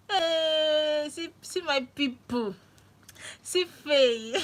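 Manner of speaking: high, loud delighted greeting to arriving viewers; real excitement. Real, not acted. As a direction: burst out with real joy at seeing friends. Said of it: "I'm so excited".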